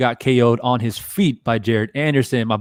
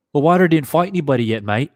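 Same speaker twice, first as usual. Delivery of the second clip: quicker, higher retake in a mock British accent; second try at the Fury fan's British accent, faster; British accent redo; 4 semitones higher than usual. Streamer redoing the Fury fan line in a quick, higher British accent. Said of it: "of a British accent".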